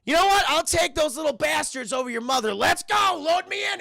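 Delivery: high, loud desperate yelling; frantic shout. Retold or imitated. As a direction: beg to be taken, shouting. Madea desperate to escape.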